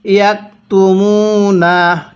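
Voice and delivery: slow, drawn-out tajweed chanting; slow Quran recitation. Shown as a demonstration. Teacher demonstrating recitation to students, slow chant. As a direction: recite each syllable long and measured. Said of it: "আমার সাথে পড়ুন".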